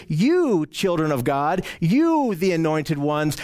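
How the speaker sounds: "you" stretched slowly before each title; single them out with a long, weighted "you"; slow, deliberate stress on "you"; emphatic you. Retold or imitated. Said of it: "John is making a point to say".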